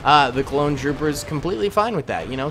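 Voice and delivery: ordinary talk, nothing marked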